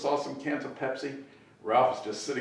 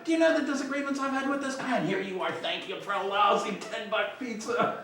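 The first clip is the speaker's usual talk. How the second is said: high, indignant imitation of a tirade; mock ranting Ralph; 6 semitones higher than usual. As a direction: scold like an aggrieved boss, playfully exaggerated. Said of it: "legendary stories about Ralph's yelling".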